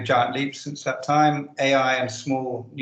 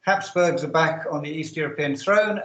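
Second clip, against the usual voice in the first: usual speaking voice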